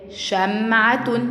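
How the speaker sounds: شمعة drawn out with short-a "sha" and full "-tun" ending; syllable-by-syllable reading. Shown as a demonstration. Teacher reads an example word to first graders, slow syllable-by-syllable reading of شمعة. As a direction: say شمعة slowly as "sham-'a-tun", each syllable held. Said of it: "الشين عليها فتحة".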